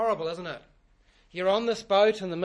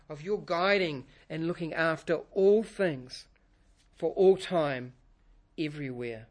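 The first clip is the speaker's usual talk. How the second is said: prayer; 4 semitones lower than usual. Real, not acted. Pastor leads opening prayer.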